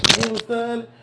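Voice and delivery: imitating himself. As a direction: play his own amazed reaction. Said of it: "So I be like".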